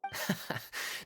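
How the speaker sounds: quiet breathy chuckle; a brief amused laugh under the breath; gentle teasing chuckle; soft chuckle. Acted. Kaya amused by nervous Paimon.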